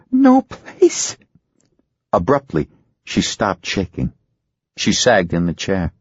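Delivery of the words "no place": much higher, distant; hollow frightened answer. Acted. Ginger in trance, high and frightened. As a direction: answer from far away in fear.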